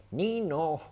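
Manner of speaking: high, loud nee-naw; excited siren cry. A narrator's reading. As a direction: bounce it out like an excited toddler. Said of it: "cries George".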